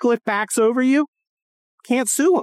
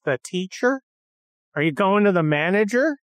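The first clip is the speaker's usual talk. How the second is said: ordinary talk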